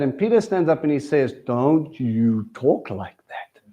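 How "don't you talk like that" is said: low, slow and soft, a quiet warning; stern rebuke. Retold or imitated. Peter rebukes his rabbi, low and slow. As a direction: hold him back with a low, slow rebuke. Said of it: "It says Peter rebuked him".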